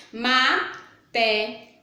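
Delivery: "Matemática" drawn out slowly, syllable by syllable; slow syllable count. Shown as a demonstration. Teacher counting syllables of "Matemática" for pupils, slowly. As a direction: stretch "Matemática" so the five syllables are heard. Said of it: "Cinco sílabas".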